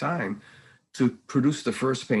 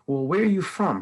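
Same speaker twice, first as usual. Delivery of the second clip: higher, louder prying question; imitated nosy question; 7 semitones higher, 5 dB louder than usual. People quizzing mom about her accent, imitated prying. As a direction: mimic people probing for an answer.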